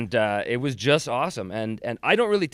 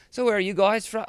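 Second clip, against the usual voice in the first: put-on higher-pitched Australian girl, lifted on WHERE; Australian accent; 10 semitones higher than usual. Girl at the bar to touring lads, mock Australian accent. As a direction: imitate an Australian girl asking brightly. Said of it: "they're Australian, and the one girl says".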